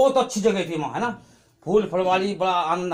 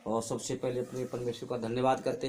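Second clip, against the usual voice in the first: low, hushed prayer of thanks; subdued, murmured devotional prayer; 9 semitones lower, 13 dB quieter than usual. Real, not acted. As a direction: pray softly to the Father, voice kept low. Opening prayer before the testimony.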